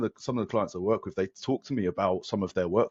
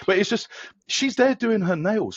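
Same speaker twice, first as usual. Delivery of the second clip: incredulous; 12 semitones higher, 4 dB louder than usual. Real, not acted. Incredulous about wife's nails.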